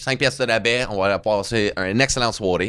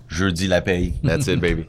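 lower, hammed-up ad-announcer read; mock promo voice; 6 semitones lower than usual. Plugging his friend's grocery day as a mock promo read. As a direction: sell the slogan like a cheesy radio spot. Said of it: "je vais faire ça comme une promo pour toi".

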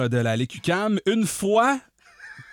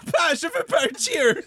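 put-on child voice; 13 semitones higher than usual. Child character in sketch.

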